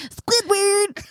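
high cartoon character voice; Squidward voice. Host demonstrates a cartoon voice to her cohost, high and odd. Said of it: "Like that. That's how he talks".

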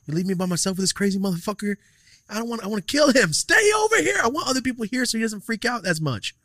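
high-pitched frantic outburst, peaking in a shout; agitated retelling. Retold or imitated. Valet cursing his friend for leaving him alone, agitated. Acted retold angry plea to others.

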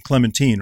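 put-on Clementine settled on as "clemen-TEEN"; CLEMENTINE ending in "teen". Shown as a demonstration. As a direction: say Clementine with a long ee at the end. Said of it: "is it Clementine or Clementine?".

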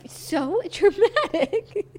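laughing through the words. Real, not acted. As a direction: laugh while teasing. Teasing friend laughs.

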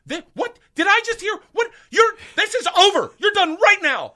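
hammed-up, high-pitched furious screaming; teacher losing it. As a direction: imitate an outraged teacher shrieking at full volume. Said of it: "loses her fucking mind".

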